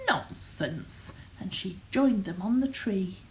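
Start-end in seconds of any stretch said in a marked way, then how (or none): 0.1-1.1 low, soft dismissive rebuke; lightly voiced scolding mother; mild scolding. A narrator's reading. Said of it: "Their mother scolded".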